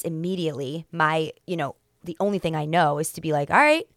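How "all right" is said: sassy, high and sharp, cutting him off; sassy attitude. Retold or imitated. Telling her crush to cut to the chase with sass. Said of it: "I gave him, like, an attitude".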